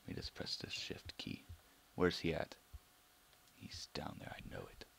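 stealthy whisper near a zombie; sneaky whisper. Real, not acted. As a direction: whisper as you creep.

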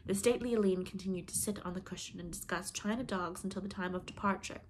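plain reading voice

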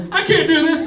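high, cracking give-up line; panicked quitting. Retold or imitated. Newcomer quitting onstage to the crowd, high and panicked. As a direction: squeak it out as you bail. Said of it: "And he goes".

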